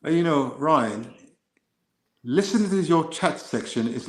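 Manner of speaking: put-on British accent, measured and exasperated. Shown as a demonstration. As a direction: play a weary Brit pleading for sense. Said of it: "I can speak with a British accent".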